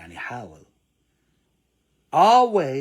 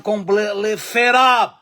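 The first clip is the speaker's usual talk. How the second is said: completely fed up in a louder American accent; 6 semitones higher, 10 dB louder, slower than usual. Shown as a demonstration. Teacher showing the class an American accent. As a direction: give it the full American accent. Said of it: "بالأمريكي هنا، أمريكان أكسن".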